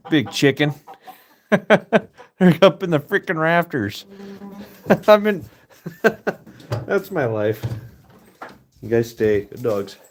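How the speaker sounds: ordinary delivery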